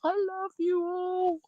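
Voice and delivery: high, soft, drawn-out croon; sister's loving call. Retold or imitated. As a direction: stretch each word out softly, up high. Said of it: "She was doing that".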